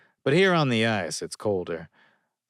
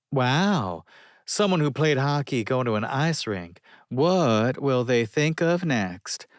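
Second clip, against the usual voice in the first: put-on sarcastic counter girl; 6 semitones higher, 4 dB louder than usual. A narrator's reading.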